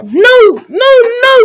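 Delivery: put-on high boyish protest; a boy's insistent objection; protesting cry. A narrator's reading. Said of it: "cried Jack".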